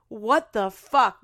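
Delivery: loud, slow, hard-hit words; exasperated outrage. Real, not acted. Demanding TikTok fix the feature, exasperated outrage. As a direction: land each word slowly and loudly.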